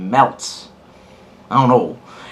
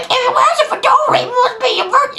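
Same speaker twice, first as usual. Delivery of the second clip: mocking the critics in a squeaky, much higher voice. To fedora critics, mocking their logic in a high squeaky voice. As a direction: parrot their logic like a whiny know-it-all. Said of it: "your whole circular logic of".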